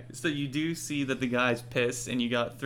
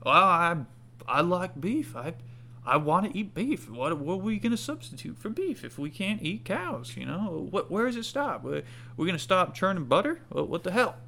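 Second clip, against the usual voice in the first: higher, indignant villager; grumbling villager; 6 semitones higher than usual. Acted. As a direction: complain about losing beef. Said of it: "you hear other people saying".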